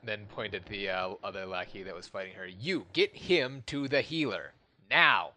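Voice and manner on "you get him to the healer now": commanding order. Acted. Captain ordering a lackey.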